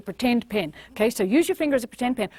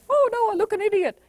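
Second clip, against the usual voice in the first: mock embarrassed; 10 semitones higher, 4 dB louder than usual. People think, self-conscious.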